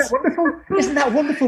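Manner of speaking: high, bright-eyed repeated exclamation; delighted exclamation. Real, not acted. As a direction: gush with real delight.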